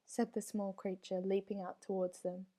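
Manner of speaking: ordinary delivery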